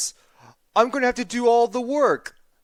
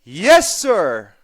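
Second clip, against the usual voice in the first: eager shout; 7 dB louder than usual. Acted. Eager answer to yak chief.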